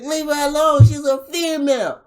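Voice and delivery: mock crowd yell; imitated yell. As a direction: shout it like the crowd. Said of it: "He's like".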